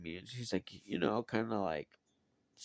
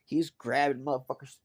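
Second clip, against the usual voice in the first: ordinary delivery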